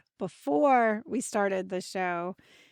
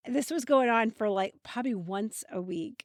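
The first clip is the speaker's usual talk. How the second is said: ordinary talk, nothing marked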